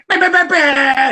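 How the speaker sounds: high, loud "bah" beat noises; mock beat drop. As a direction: blurt the beat as a sudden surprise attack. Mock surprise beat drop in training.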